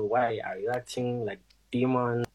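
usual speaking voice

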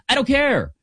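high, pitch jumps up on care; emphatic I don't care. Real, not acted. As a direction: toss it off with a big rising flourish. Emphatic repeated dismissal.

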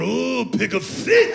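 drawn-out mock "ooh" of a listener; imitated audience member. Girl in the audience reacting to pig feet, exaggerated.

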